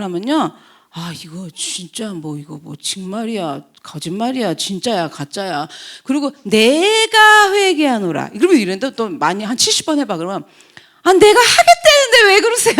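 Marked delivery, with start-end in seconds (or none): 0.9-5.7 low, soft musing to oneself; doubtful muttering. Acted. Acts out doubting the repentant person. 6.4-8.3 slow, high-pitched mock declaration; solemn proclamation. Repeats the acted repentance declaration. 11.0-12.7 high-pitched hammed-up indignation; whiny protest. Acts the repeat offender protesting.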